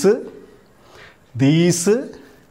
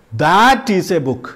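slow example sentence; much slower than usual. Shown as a demonstration. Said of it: "എന്ന് വെച്ചാൽ എന്താ അർത്ഥം".

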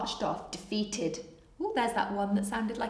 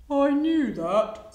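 slow, louder, higher crocodile reply; bluffing crocodile; 5 semitones higher, 6 dB louder, slower than usual. Acted. Crocodile tricked by monkey, bluffing slowly. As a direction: drag it out, pretending you knew.

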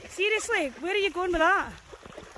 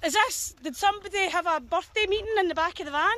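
ordinary talk, nothing marked

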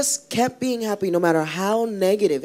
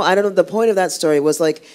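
normal talking voice

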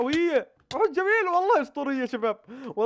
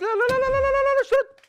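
panicked no; 13 semitones higher, 7 dB louder than usual. Real, not acted. Panicked gamer under attack.

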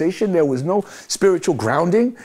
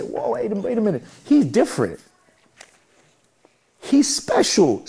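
acted amazement; 6 semitones higher than usual. People around notice his positive energy, acted amazement. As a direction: pull up short in mild astonishment. Onlookers amazed by someone's change.

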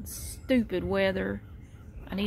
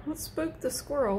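usual speaking voice

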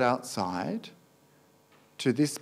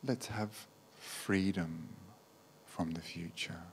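guided meditation; 3 semitones lower, 5 dB quieter than usual. Acted. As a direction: gently guide them into letting go, unhurried.

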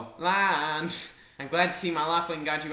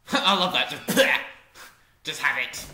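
laughing character voice; 6 dB louder than usual. Acted. Voicing game character lines.

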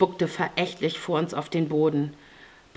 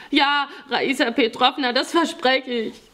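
high, earnest youngster's voice; eager pleading boy; 7 semitones higher than usual. A narrator's reading. Boy vows to Raisa never again, eager pleading. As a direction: promise it like a desperate child. Boy promises, begging for release.

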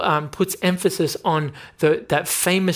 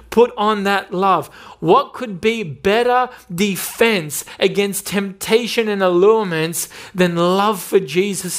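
louder, higher, impassioned preacher; preaching at full force; 4 semitones higher, 4 dB louder than usual. Acted. Preacher urging love for Jesus over temptation, impassioned and loud. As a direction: build the fire with each love.

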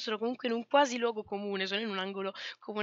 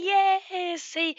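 high, loud happy yeah; excited cheer; 8 semitones higher, 7 dB louder than usual. Real, not acted. Streamer cheering a viewer's two-year sub, loud and high. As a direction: cheer them on.

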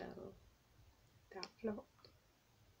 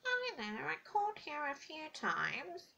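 higher disguised caller voice; put-on voice; 7 semitones higher, 5 dB louder, slower than usual. A prankster to her mum, higher disguised voice. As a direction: fake a stranger's voice for mum. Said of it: "What kind of voice was that anyway?".

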